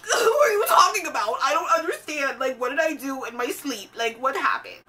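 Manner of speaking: loud, high mock wail of confusion, easing toward the end; hammed-up baffled cry; exasperated outburst. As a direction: wail it like someone wrongly accused. Acts out exasperated reply to accusation.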